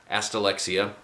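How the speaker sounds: ordinary delivery, nothing marked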